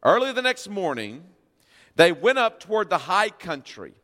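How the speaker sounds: ordinary talk, nothing marked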